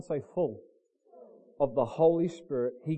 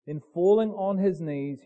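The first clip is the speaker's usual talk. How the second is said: ordinary talk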